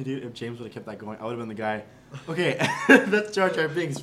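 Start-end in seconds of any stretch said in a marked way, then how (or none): 2.3-4.0 louder and higher, laughing through the words. Pretend. Picturing himself at the trailer panel, laughing through it. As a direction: giggle out the silly claim, slower.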